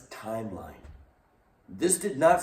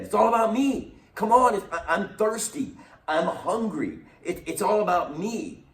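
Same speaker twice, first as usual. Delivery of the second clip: high, loud whining "it's all about me"; whiny selfish Israelites; 10 semitones higher, 4 dB louder than usual. Retold or imitated. Preacher mimics whining Israelites to his class: high, loud selfish whine. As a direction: whine like a spoiled child who wants everything.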